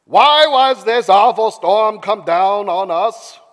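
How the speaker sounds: loud, slow, put-on character voice; hammed-up drawn-out accusation; demanding sailors. A narrator's reading. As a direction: demand an answer in a theatrical voice. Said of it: "they demanded".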